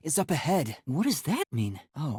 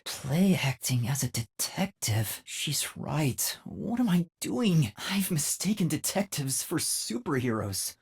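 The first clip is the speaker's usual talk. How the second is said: normal talking voice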